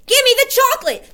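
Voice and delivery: put-on very high, loud, fast boy shout; shouted demand. A narrator's reading. Bruno shouting at the Grand High Witch for chocolate. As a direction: shout it like a furious kid. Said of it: "shouted Bruno".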